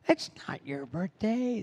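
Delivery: high, slow mock kids' yell; kids yelling. Kids correcting the clown, high slow mock yell. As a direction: chant it like a crowd of kids. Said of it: "the kids are all yelling at me".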